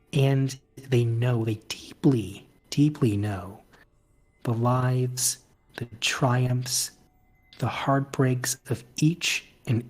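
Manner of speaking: measured, storytelling scene-setting; game master narration. Acted. Game master describing the sky whales to a player, measured narration. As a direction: narrate it gently, with long pauses between phrases.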